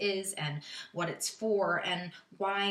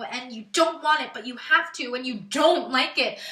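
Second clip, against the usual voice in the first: loud, pitched-up, urgent retelling; agitated, with hard loud bursts on the struggle; rising distress; 3 semitones higher, 7 dB louder than usual. Acted. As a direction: get louder as the trap closes in. Acting out being trapped and forced.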